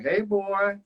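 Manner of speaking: put-on high southern accent call. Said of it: "my southern accent".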